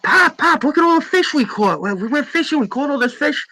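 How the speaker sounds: high-pitched, eager mock sons; excited sons. Sons showing Pop their catch, excited. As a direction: call out like boys showing off to Dad. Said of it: "they're like".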